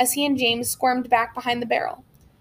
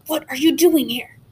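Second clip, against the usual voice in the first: ordinary delivery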